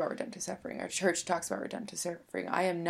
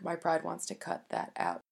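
usual speaking voice

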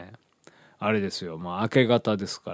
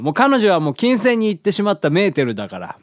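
dramatic high exclamation; 11 semitones higher, 6 dB louder than usual. Retold or imitated. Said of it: "みたいな".

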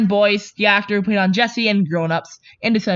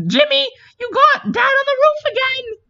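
put-on high-pitched exasperated yell to a kid; mock scolding parent. Imagined parent scolding Jimmy over the roof, mock exasperated yell.

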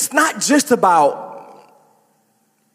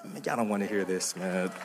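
much softer, lower, half to himself; quiet aside; 13 semitones lower, 16 dB quieter than usual. Real, not acted. Pastor to congregation, quiet teasing aside. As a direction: mutter it as a wry aside. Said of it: "aside to congregation".

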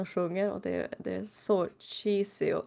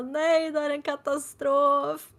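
mock despair; 8 semitones higher, 3 dB louder, slower than usual. [name] despairs over wrong box.

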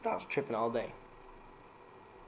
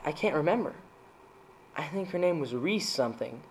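usual speaking voice

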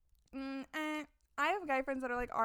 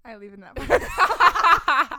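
slow, loud teasing through laughter; laughing tease; 16 dB louder, much slower than usual. Real, not acted. Cohost teasing her friend about the edit, laughing loudly.

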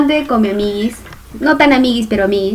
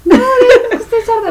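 high, laughing protest; protesta riendo; 10 semitones higher than usual. Real, not acted. Laughing protest at being called deaf. (queja aguda entre risas)

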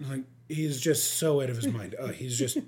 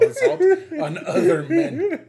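laughing; 13 semitones higher, 6 dB louder, slower than usual. Real, not acted. Laughing at own joke.

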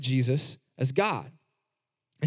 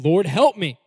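insistent plea; 3 semitones higher, 6 dB louder than usual. Retold or imitated. The woman begs Jesus once more, loud and insistent. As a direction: refuse to give up, cry it out. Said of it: "was not to be deterred".

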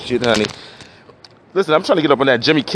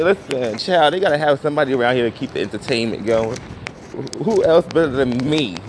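ordinary talk

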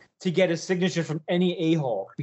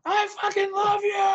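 screaming fan. Retold or imitated. Fan to his guitar hero, screaming adoration. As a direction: scream your adoration in a strained high voice.

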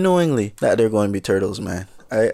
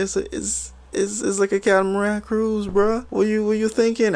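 much higher, incredulous scolding of his friend; exasperated high retort; 10 semitones higher than usual. Retold or imitated. To panicking friend on catamaran, incredulous high scold. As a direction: pitch it way up like you can't believe him.

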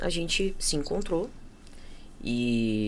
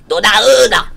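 loud call across a room; louder call for Dona Ana; 3 semitones higher, 15 dB louder than usual. Retold or imitated. Someone calling her name.

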